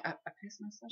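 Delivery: very soft, high, halting confession; trying to be quiet. Retold or imitated. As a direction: mumble it secretly so nobody overhears. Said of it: "trying to be so fucking quiet".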